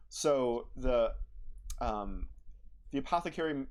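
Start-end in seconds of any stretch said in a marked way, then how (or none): none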